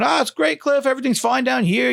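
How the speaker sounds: mock-upbeat phone greeting; coworker's voice. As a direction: chirp it to the boss's dad. Coworker Tony on phone with dad.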